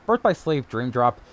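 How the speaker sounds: ordinary talk, nothing marked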